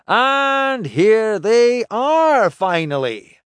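loud, high, drawn-out hail; calling out. Acted. Drinker hails his crew along the walkway, calling out. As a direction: stretch the words out to greet latecomers.

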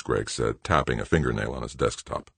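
plain reading voice

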